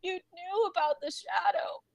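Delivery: weeping, wounded protest; continuing to cry. Acted. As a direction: plead tearfully between heaving sobs. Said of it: "He continues to cry".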